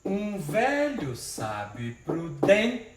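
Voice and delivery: drawn-out recited verse, syllable by syllable; slow poetic scansion. Shown as a demonstration. Teacher reciting the verse slowly for the class. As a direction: stretch each syllable like reciting a poem. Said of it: "a contagem é até a sílaba tônica".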